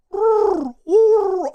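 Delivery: loud, high owl-like howl; high, mournful wordless hooting; failed Chewbacca roar. Shown as a demonstration. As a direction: attempt a Chewbacca roar that comes out as a sad owl hoot. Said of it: "I just sound like a freaking owl".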